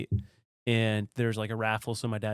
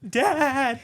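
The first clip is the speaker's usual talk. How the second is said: calling out; 13 semitones higher than usual. Retold or imitated.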